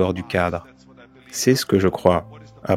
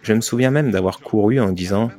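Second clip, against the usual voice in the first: ordinary delivery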